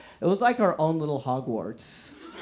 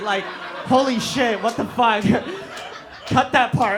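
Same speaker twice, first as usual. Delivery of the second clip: higher, louder, laughing through it; laughing in shock; 7 semitones higher, 5 dB louder than usual. Real, not acted. Comic realizing his joke, laughing shocked. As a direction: crack up at your own slip.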